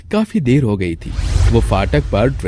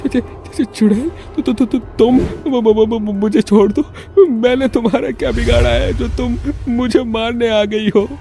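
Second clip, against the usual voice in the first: very high, panicked stuttering plea; terrified stammer; 13 semitones higher than usual. Acted. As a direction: stutter on first sounds, begging in fright. Terrified man stammers at witch. (डर से हकलाती ऊँची आवाज़)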